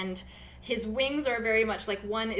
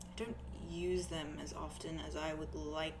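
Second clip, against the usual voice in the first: soft, shy reply; 3 semitones lower, 8 dB quieter than usual. Acted. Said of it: "He's like".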